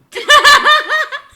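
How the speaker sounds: loud high-pitched laughter burst; high shrieking laugh. Real, not acted. Reacting to his own story, shrieking laugh.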